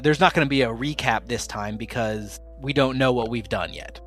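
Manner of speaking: ordinary talk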